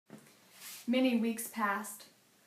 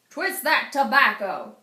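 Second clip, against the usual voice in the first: loud gruff boss command; boss barking order; 3 semitones higher, 10 dB louder than usual. Acted. Boss yelling at Henry, loud harsh command. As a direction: bark it like a harsh overseer.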